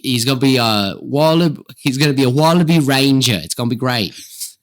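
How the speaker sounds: put-on butchered Australian accent; hammed-up Australian, slipping towards British; bad Australian accent. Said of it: "usually my Australian just goes straight to British".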